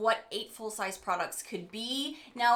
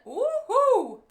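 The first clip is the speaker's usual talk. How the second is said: high, loud whoop of excitement; excited cheer; 9 dB louder than usual. Real, not acted. Vlogger super excited about a new product, excited cheer. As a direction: let out a big, high-pitched woohoo. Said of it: "I am super excited".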